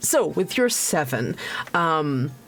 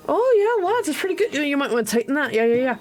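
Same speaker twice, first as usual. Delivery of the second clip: high, quick, breezy approval; rapid-fire chummy expertise, pitched way up; bluffing know-it-all; 9 semitones higher, much faster than usual. Acted. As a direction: act like you know what you're doing. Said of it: "acting like he knows what he's doing".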